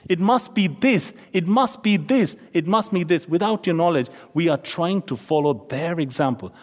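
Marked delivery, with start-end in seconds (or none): none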